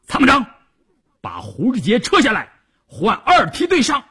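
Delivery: high, forceful command; urgent battle order. A narrator's reading. Commander to his chief of staff, ordering urgently. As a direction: call out orders to your staff.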